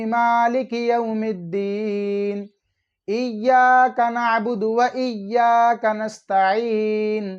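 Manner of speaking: measured recitation with long, held vowels; unhurried tajweed demonstration; slow Quran recitation. Shown as a demonstration. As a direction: recite slowly and clearly, drawing out each long vowel. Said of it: "मैं सुनाऊंगा आहिस्ता आहिस्ता".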